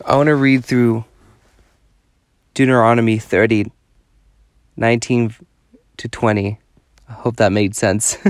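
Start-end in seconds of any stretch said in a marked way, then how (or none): none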